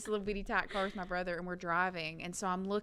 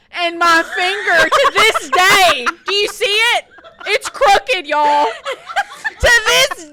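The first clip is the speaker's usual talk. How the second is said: high, loud laughing disbelief; laughing shriek; 12 semitones higher, 18 dB louder, slower than usual. Real, not acted. Telling friends about her crooked finger, laughing loudly. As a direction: squeal through laughter.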